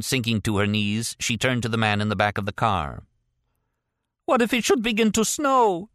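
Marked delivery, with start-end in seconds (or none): 4.3-5.9 put-on much higher, pleading old woman's cry. A narrator's reading. Frail woman cries to the oligarch, high and pleading. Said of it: "she cried".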